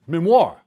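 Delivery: "Memoir" said as mem-WAR, punch on the end; his own pronunciation. Shown as a demonstration. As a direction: give "Memoir" the oomph on the last syllable, mem-WAR. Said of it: "in the way I pronounce it".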